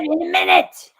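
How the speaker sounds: lightly voiced grumpy reply; Stu Hart impression. Husband stalling his wife, lightly grumpy. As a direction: brush her off, a bit high. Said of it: "I love your Stu Hart impression".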